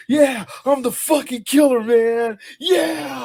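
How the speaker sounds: loud, high cocky shout; Bill Paxton. Retold or imitated. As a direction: brag like a hyped-up marine. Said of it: "You got Bill Paxton doing".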